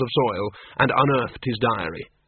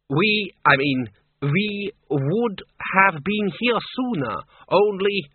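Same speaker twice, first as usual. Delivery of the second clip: high, slow theatrical countess; high-pitched would-be vampire lady; 7 semitones higher, slower than usual. Acted. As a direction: play a grand mock-Transylvanian countess, drawn out.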